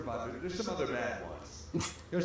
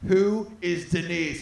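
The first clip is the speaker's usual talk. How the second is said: loud, slow accusation; imitated angry girlfriend; mock jealous demand; 3 semitones higher, 8 dB louder, slower than usual. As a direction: confront a caught cheater, hammed up. Acting girlfriend confronting cheating Stu.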